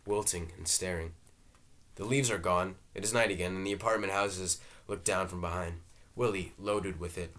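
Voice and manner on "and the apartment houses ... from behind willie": ordinary delivery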